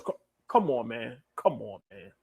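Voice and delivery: fighting back tears, barely audible; choked up. Real, not acted. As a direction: voice nearly gone with emotion. Overwhelmed by viewers' support.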